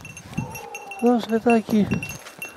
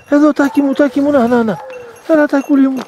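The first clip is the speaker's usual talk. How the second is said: high, loud call across the field; 7 semitones higher, 6 dB louder than usual. Real, not acted. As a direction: call the dog over from far off. Calling the dog back in.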